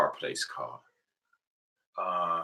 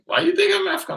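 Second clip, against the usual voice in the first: much higher, louder disbelieving question; incredulous retort; 7 dB louder, faster than usual. Retold or imitated. Asking the friend why he thinks that, in high disbelief. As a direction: throw it back at him, amazed he'd say that.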